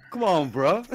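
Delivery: mock exasperation. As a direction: plead at the cyclists. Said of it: "I was like".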